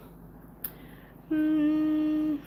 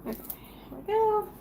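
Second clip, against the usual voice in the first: ordinary delivery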